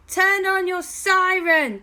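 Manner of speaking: high, loud urgent shout. Acted. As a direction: yell the order across the street. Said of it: "as loud as they possibly could".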